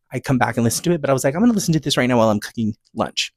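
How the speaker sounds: normal talking voice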